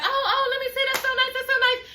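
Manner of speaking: high, airy mock-gushing compliments; mock gushing girls. Cast mates fawning over Wendy's new look, high mock-gushing. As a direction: coo like fake-sweet friends. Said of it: "everybody's like".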